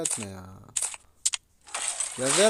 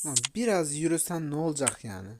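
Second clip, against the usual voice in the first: usual speaking voice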